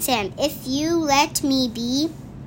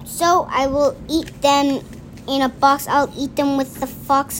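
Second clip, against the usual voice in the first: ordinary delivery, nothing marked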